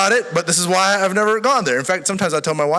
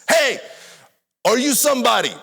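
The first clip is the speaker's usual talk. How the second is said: high, loud call from a crowd; mock a stranger shouting across at him; retold shout; 8 semitones higher, 3 dB louder than usual. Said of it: "this lady from the crowd shouts at me".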